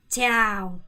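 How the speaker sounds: 짜오 falling all the way down, loud. Shown as a demonstration. Teacher models greeting, loud falling tone. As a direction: drop 짜오 to the bottom of the voice. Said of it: "끝까지 내리세요".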